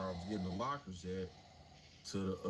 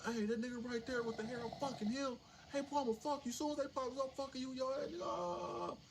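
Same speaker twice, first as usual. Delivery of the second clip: much higher, taunting mock yell; inmates yelling. Inmates yelling threats at newcomer, high mock taunting. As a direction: taunt the new guy from the cells.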